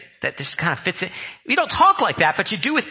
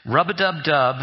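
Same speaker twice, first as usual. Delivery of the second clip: joke prayer through laughter; 3 semitones lower than usual. Retold or imitated. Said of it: "It goes like this".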